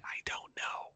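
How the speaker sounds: breathy whispered aside. Acted. Host confessing he never preps, in a whisper. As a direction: drop to a secret whisper. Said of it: "whispered aside".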